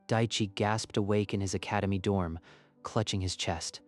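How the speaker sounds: usual speaking voice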